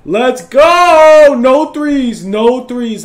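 loud laughing cheer, pitched high; excited celebration. Real, not acted. Gamer celebrating then rallying defense, excited shouting. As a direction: whoop it up, then keep shouting.

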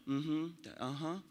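slow, soft, absent-minded mm-hmm; bored husband. Retold or imitated. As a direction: humor her without really listening. Bored husbands half-listening.

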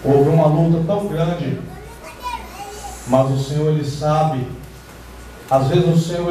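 ordinary talk

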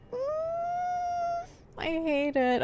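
mock crying. Fake crying over villager.

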